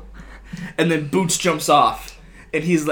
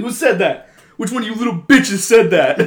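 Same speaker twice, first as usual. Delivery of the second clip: mock angry; 7 semitones higher, 6 dB louder than usual. Retold angry president.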